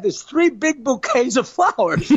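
usual speaking voice

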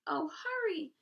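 high loud hurried pig; urgent pig. A narrator's reading. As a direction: rush him, high and loud. Wilbur urging the rat.

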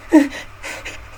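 a high, airy fake sob; mock sob. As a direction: sob theatrically to mock him. Ha, ağladın yani.

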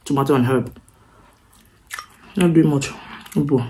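chewing while talking; muffled by food in mouth; mouth full. Real, not acted. Seen 2: Took noodle bite at -4; lips pressed, chewing through speech.

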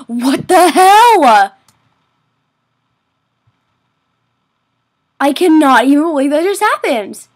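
stretched-out, outraged outburst; loud disbelief. Real, not acted. Gamer reacts to a sudden mishap, loudly aghast. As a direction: exclaim loudly, aghast at what happened.

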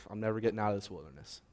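quick, quiet hopeless sigh of a line; soft, hurried despair after a sigh. Acted. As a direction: rush it out as if giving up. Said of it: "Or you can despair".

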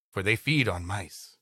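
ordinary talk

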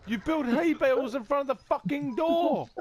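high, loud angry rant; angry yelling. Real, not acted. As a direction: yell at him for blocking the door. Furious after dying in game.

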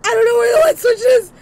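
fast, high panicked rush; frantic panic. Real, not acted. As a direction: blurt it out in a panic. Frantic search in the dark.

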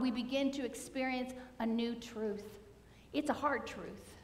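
usual speaking voice

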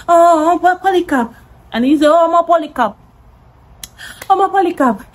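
put-on high voice, laying on the excuse; mock plaintive caller. Acting out the boy's excuse call.

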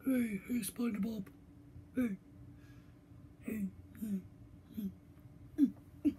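a hint of Patrick, soft and dopey; lightly voiced starfish calling out; Patrick impression. Kid doing a quiet, lightly voiced Patrick calling SpongeBob. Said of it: "I can do Patrick".

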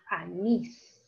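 "anís" slowly split, a-NÍS; stress on last syllable. Shown as a demonstration. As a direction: separate "anís" slowly, leaning on final NÍS. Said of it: "en la última sílaba está la mayor fuerza". ("anís" lento, marcando la última sílaba: a-NÍS)

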